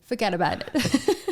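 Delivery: put-on hammy New York accent, rising. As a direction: play a thief in bad New York accent. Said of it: "Terrible New York accent".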